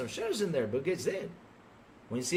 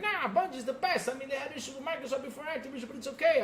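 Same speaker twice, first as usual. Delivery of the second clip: mocking naive fan; 8 semitones higher than usual. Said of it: "I was like".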